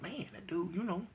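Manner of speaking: hushed, unvoiced gossip between people; retold whisper of people gossiping. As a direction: whisper it like talking behind his back. People gossiping about him quietly.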